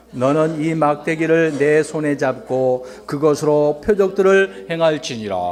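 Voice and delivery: slow, measured verse reading; scripture reading. Real, not acted. Reads a Bible verse aloud. (또박또박 느리게 성경 봉독)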